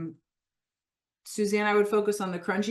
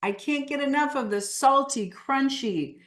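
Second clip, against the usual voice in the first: emphatic, animated; 3 semitones higher than usual. Shown as a demonstration. Coach modelling a craving for the group to repeat, emphatic. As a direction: build in emphasis through the list. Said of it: "when you say it emphatically".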